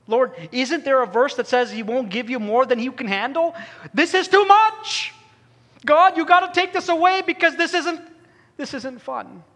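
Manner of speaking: mother at the bedside crying out to God in anguish. Retold or imitated. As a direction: cry out like a mother at the end of her rope. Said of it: "She is crying buckets of tears".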